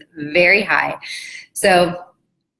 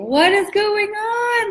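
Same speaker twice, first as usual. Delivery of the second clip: very high, drawn-out squeal of disbelief; exaggerated slow, high-pitched bafflement; mock disbelief; slower than usual. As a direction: stretch it out way up high, incredulous. Acting out shocked reaction to coach.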